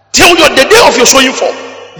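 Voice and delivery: loud, high preaching cry; proclaiming. Real, not acted. Preacher proclaiming scripture to the congregation, loud and high. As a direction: ring it out from the pulpit.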